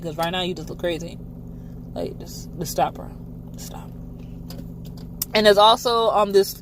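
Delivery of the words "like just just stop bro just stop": trailing off to a near whisper; fading plea. Real, not acted. Telling Calvin to stop, fading to a hush. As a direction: plead softly for him to stop. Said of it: "bro, just stop it".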